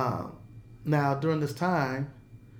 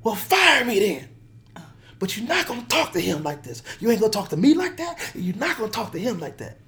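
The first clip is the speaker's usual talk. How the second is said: higher, louder, furious defense; fired-up uncle shouting down the phone; going off on him; 7 semitones higher, 3 dB louder than usual. Retold or imitated. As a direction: tell the caller off hard, protecting the kid. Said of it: "he starts going off on him".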